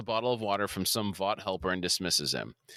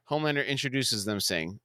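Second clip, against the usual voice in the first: ordinary talk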